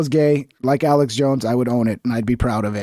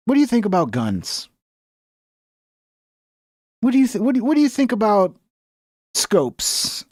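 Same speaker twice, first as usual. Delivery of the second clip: higher, leading, insinuating questions; mock fed recruiter; 6 semitones higher than usual. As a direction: lure the kid toward guns.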